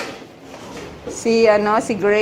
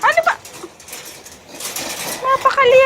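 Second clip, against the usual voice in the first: much higher, exasperated voice; pet scolding; 11 semitones higher, faster than usual. Acted. Owner scolding the noisy pets in a much higher voice. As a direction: scold the animals up high.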